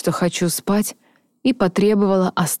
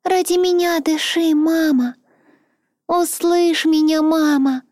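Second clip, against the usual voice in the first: высокий детский голосок, умоляюще; умоляй как маленькая девочка; 10 semitones higher than usual. Acted. Дочь умоляет маму. (умоляющий детский голосок)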